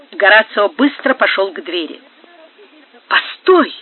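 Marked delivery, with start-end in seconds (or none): 3.1-3.7 higher, frightened outcry; terrified cry. A narrator's reading. Альмендра cries out in terror as Горацио leaves. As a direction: call him back in mortal fear. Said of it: "крикнула Альмендра в смертельном испуге".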